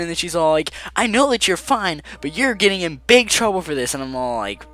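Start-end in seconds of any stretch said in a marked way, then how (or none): 0.9-3.9 higher, louder stern rebuke; a hammed-up strict teacher; mock scolding coach. Voicing angry coach.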